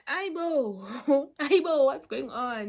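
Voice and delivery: retold astonished outburst; shocked exclamation. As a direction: exclaim it, stunned, with a little laugh. Retold shock at the scene outside.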